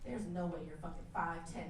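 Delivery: low, quiet deadpan put-down; dead serious. Retold or imitated. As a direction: deliver the insult coldly, under control. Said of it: "looked him dead in his eye".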